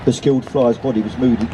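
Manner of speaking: ordinary delivery, nothing marked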